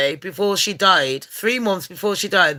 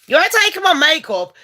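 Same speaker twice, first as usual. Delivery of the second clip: ranting; 8 semitones higher, 3 dB louder, faster than usual. Acted. Ranting at ghost in the house.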